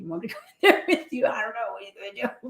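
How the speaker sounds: fast, laughing nervous colleague; laughing quote. Retold or imitated. Nurse hesitating to join a tense room, laughing. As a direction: rush it out through laughter. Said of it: "some of them just be like".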